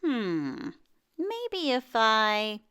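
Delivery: slow, musing half-thought; slow pondering. Acted. Earl thinking aloud while shaving, slow pondering. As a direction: drag it out as if still working it out. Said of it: "Earl thought while he shaved".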